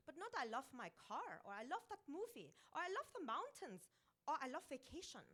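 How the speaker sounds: Vilma's Austrian voice. Retold or imitated. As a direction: play Vilma with a mock Austrian accent, chatty. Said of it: "It includes funny voices".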